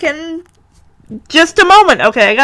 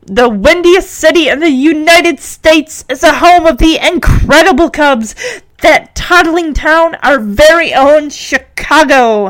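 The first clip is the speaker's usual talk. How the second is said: proud; 5 semitones higher, slower than usual. Acted. Henry answers into the phone, proudly. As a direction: savour each phrase with hometown pride. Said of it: "Henry says proudly into the phone".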